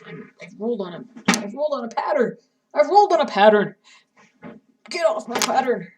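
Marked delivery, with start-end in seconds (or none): 1.4-3.7 alarmed exclamation. Real, not acted. Rolling chair onto her pattern. 4.9-5.9 loud exclamation. Real, not acted. Chair rolled onto pattern.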